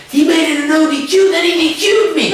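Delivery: high, heated rant at the promoter. Retold or imitated. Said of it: "I mean, I'm going off".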